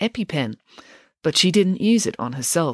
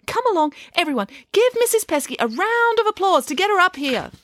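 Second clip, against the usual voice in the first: put-on high, syrupy old lady voice; a theatrical, patronising dame; gushing grand dame. Dame Bronwyn rallies the crowd.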